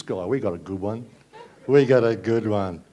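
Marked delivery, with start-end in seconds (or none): 1.7-2.8 slow, louder sarcastic repeat; sarcastic emphasis. Shown as a demonstration. Comedian mocking Tony Abbott to the crowd, slow loud sarcasm. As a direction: lean on it, dripping sarcasm.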